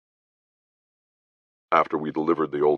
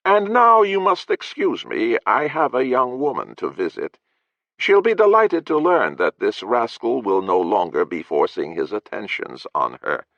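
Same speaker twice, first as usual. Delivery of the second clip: smug lord; 9 semitones higher, 4 dB louder than usual. Acted. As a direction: savour the insult, lordly. Lord's held character voice.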